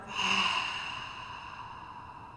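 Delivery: long open-mouthed sigh; demonstrated sigh. Shown as a demonstration. Teacher shows how to release a held breath, long sigh. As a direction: let all the breath pour out in a long, fading sigh. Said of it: "let the breath totally pour out with a sigh".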